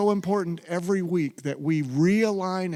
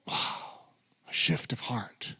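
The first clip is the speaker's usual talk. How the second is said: whisper; 3 semitones lower, 8 dB quieter, slower than usual. Real, not acted. Awed aside to congregation.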